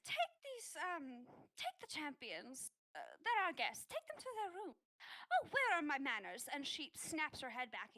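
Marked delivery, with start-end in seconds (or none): 0.1-6.5 a hint of a brisk priestess ordering her helper; lightly voiced priestess giving orders. Priestess ordering the shy girl to escort the guests, brisk. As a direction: snap out the orders with a touch of command.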